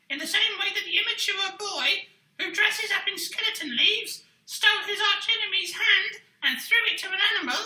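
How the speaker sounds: high, cheeky puppet voice rattling on. Acted. Puppet mocks the host for stealing jokes, high cheeky voice. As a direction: tease him like a smug little puppet.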